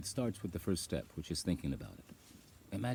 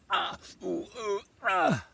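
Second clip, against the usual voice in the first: high, loud yelps of pain; pain groans; 7 dB louder, slower than usual. Retold or imitated. Recalling trying to stand on a sore foot, yelping. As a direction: cry out as if every step hurts.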